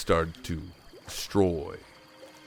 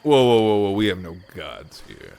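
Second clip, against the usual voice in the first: calming whoa; 5 dB louder than usual. Acted. GM calms heated character.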